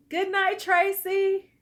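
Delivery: high and loud call-out; teasing goodnight. Real, not acted. Laughing goodnight to her viewer Tracy, high and loud. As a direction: sing out her name.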